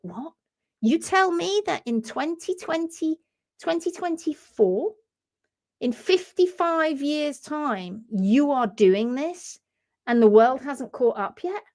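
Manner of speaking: acting a stunned, incredulous scientist. Doctor Adams reacting to news from the future, incredulous. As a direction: draw out the disbelief, slowly. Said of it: "come out of there and go".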